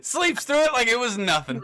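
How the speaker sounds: high, excited crowing; amused disbelief. Real, not acted. Game master marvelling that the player slept through it, excited. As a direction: crow it through the tail of a laugh.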